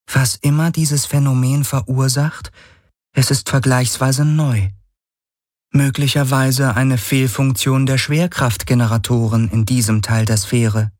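an AI musing over a strange phenomenon; cool machine entity. Acted. Voice of the Schattenhelix.

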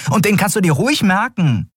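high-pitched, upset retort; piqued little character voice, high; indignant. A narrator's reading. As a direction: remind him indignantly, pitched right up. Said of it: "erinnerte Nelen ihn aufgebracht".

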